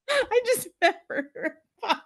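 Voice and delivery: high-pitched, breaking into laughter; laughing amazement. Retold or imitated. Fan realises whom she met in the bathroom, laughing in amazement. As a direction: laugh through the punchline.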